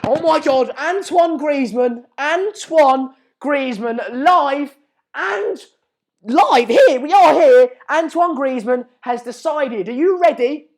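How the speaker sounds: loud, thrilled breaking-news call; hyped-up live announcer; excited announcement. Real, not acted. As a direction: shout the big reveal to the viewers.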